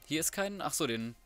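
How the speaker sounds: normal talking voice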